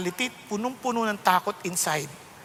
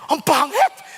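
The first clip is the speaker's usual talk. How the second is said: louder, higher cry of disgust; loud exclamation; 4 semitones higher, 6 dB louder, slower than usual. Real, not acted. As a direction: blurt it out, appalled. Seeing himself on TV.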